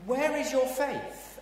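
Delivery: high, slow, exasperated asking; exasperated question. Acted. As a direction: let a touch of exasperation show. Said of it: "a bit of exasperation".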